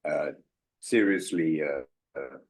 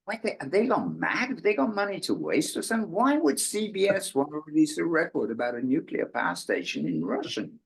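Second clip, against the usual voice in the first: raised-pitch retold disbelief, as if they've gone mad; mock disbelief; 7 semitones higher than usual. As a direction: replay the amazement, pitched up. Retelling their disbelief at CBS.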